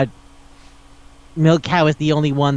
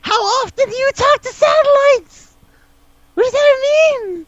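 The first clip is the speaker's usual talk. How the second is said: much higher, squeaking through laughter in disbelief; real laughing disbelief, pitched way up; incredulous laughing squeal. Real, not acted. Disbelief at film line.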